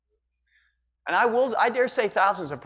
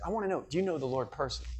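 earnest quiet question; 3 semitones lower, 8 dB quieter, faster than usual. Retold or imitated. Missus Dunkel asking earnestly.

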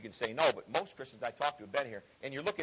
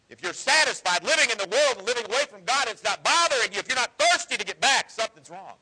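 high, loud rising sermon; preaching at full force; 7 semitones higher, 8 dB louder than usual. Acted. Preacher warning comfortable believers, rising high and loud. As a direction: build it louder and higher at the church.

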